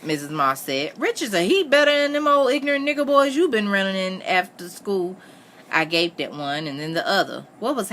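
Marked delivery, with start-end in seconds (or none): 1.0-5.2 high, emphatic mother's scolding; outspoken mother. Acted. Missus Moss comparing Richard to her daughter's boys, emphatic. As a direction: press the point in a raised pitch.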